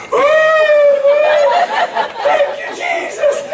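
very high, squealing praise, imitated; joyful outcry. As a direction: mimic a woman shrieking her thanks from the steps. Imitates healed woman's joyful outcry.

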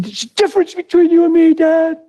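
put-on a distraught fourteen-year-old pleading with his dad, high; teenage son's voice. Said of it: "He said".

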